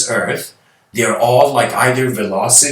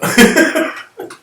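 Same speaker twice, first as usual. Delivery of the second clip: breathy laugh; 5 dB louder than usual. Real, not acted. Laughing at own joke.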